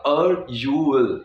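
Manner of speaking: or you will fused into one slow word; linked or you will. Shown as a demonstration. Teacher repeating or you will slowly as one word. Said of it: "एक word बन जाएगा".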